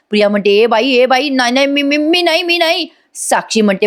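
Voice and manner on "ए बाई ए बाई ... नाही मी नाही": panicked denial. Acted. Priya panics, denying.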